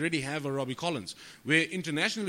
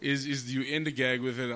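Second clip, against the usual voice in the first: usual speaking voice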